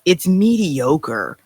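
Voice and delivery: dragged out, word by word, slow; slow, withering put-down; slow verdict. Real, not acted. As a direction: spell out the disdain slowly. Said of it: "deliberate slow verdict".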